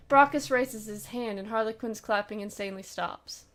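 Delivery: ordinary talk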